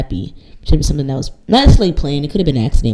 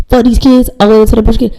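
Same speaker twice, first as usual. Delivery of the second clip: higher and louder, tossing it off; brash defiant yell; 6 semitones higher, 6 dB louder than usual. Retold or imitated. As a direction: bold carefree shout of defiance. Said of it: "when I say I was".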